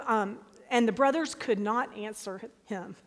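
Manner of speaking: usual speaking voice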